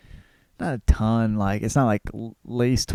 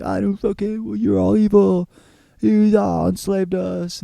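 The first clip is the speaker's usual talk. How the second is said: high-pitched mock indignant girl; heroine's voice pitched way up, defiant; pissed-off heroine; 9 semitones higher than usual. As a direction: throw it back at them, hammed-up outrage. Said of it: "and she's pissed".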